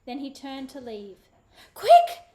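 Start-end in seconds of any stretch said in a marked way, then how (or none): 1.8-2.2 sudden sharp call, much higher and louder; urgent warning to hide; urgent hissed cry. A narrator's reading. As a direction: snap it out to warn his sister. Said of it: "Joshua hissed".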